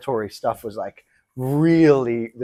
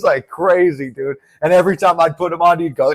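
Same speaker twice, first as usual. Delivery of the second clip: usual speaking voice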